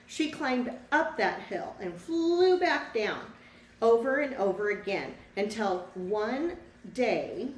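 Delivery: lively storybook read-aloud for a class; reading to children. A narrator's reading. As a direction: tell the story to the children, bright and clear. Teacher reads picture book aloud.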